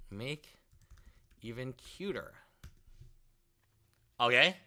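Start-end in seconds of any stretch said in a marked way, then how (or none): 0.1-2.3 barely voiced, mumbling as he types; muttered prompt. Real, not acted. Streamer mumbling a prompt to himself while typing.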